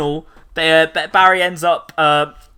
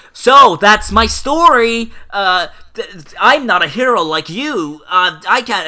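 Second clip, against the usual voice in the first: slow, higher put-on hero voice; moping it out; mock Barry; 6 semitones higher, 5 dB louder than usual. Barry telling Arrow he's no hero, higher and slower. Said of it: "he's like".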